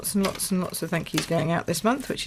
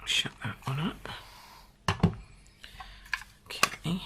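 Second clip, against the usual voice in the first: quick whisper under the breath; whispered aside; 3 semitones lower, 8 dB quieter than usual. Real, not acted. Crafter muttering to herself mid-demo, whispering. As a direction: whisper it, as if not meant for viewers. Aside while handling the punch.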